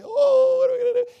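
high-pitched wailing, hammed up; exaggerated crybaby despair; mock crying. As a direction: fake a loud, helpless wail. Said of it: "feeling bad and is crying".

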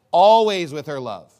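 "Always" hit loud, the rest falling away; stress on ALWAYS. Shown as a demonstration. Preacher showing the congregation the verse's emphasis, loud stress on "Always". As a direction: punch "Always" hard at the start. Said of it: "What's the emphasis of this?".

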